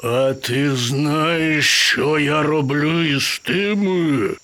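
very slow, drawn-out menacing lion; roaring lion. Acted. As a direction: roar each word out long and threatening. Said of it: "проривів Лев". (грізний левиний рев, протяжно)

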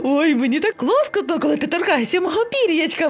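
high, squirming ticklish squeals; ticklish exclaiming parrot. A narrator's reading. Parrot being tickled by monkey, exclaiming. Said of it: "вигукнув папуга".

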